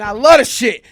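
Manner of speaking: loud defiant yell; shouting. Retold or imitated. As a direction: yell it back through the pain. Shouting while being beaten.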